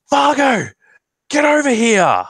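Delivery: high, loud shout across the beach; high loud call to a big friend across the sand. Acted. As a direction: yell for Fargo to come over. Calling Fargo across the beach.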